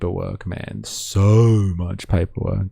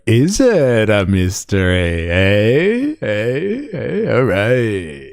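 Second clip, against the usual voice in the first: slow, drawn-out teasing "is it a mystery, eh?"; a goofy put-on voice, teasing; much slower than usual. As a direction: tease him in a goofy put-on voice.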